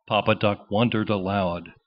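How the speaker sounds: ordinary delivery, nothing marked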